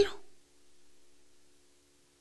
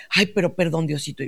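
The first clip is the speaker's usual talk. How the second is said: soft, lower mock prayer; hushed apology; 4 semitones lower, 7 dB quieter than usual. Preacher voicing the nun begging God's pardon, hushed. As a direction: drop into a quick, contrite murmur to God.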